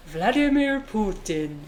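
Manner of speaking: slow, hammed-up Russian accent on "Vladimir Putin"; exaggerated Russian accent. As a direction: drag out "Vladimir Putin" like a newsreader suddenly going full Russian accent. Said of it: "extremely Russian accent".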